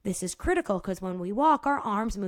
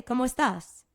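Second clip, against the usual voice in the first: put-on amateur try at a Spanish accent; Spanish greeting in a modest Spanish accent; attempted Spanish accent. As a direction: say it as a native would, lightly Spanish-accented. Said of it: "trying to do a Spanish accent".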